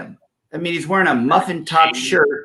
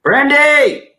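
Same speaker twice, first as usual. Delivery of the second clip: high, loud drawn-out holler; playful yell; 11 semitones higher, 6 dB louder than usual. Pretend. Co-host yelling at a viewer who is working, playful holler. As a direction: yell the name to get attention. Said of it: "You might have to yell at me".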